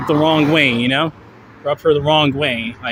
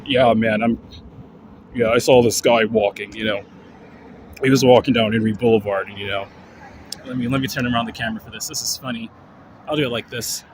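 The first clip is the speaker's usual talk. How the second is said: low, laid-back gym bro, lower; bodybuilder dude voice; 6 semitones lower, faster than usual. Pretend. Vlogger voices the dog as a human bodybuilder, low and laid-back. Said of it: "guys who kinda sounded like".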